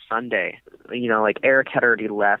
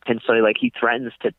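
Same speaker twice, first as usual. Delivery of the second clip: ordinary talk, nothing marked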